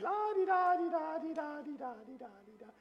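high toddler singsong, played by dad; baby singsong. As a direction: sing it like a carefree one-year-old on the stairs. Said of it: "and she's going".

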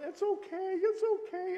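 high, soothing imitation of the wife; consoling wife. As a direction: hush him like a crying husband. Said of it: "And she's going".

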